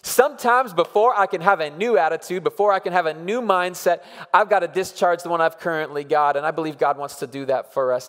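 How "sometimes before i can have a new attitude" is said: ordinary talk, nothing marked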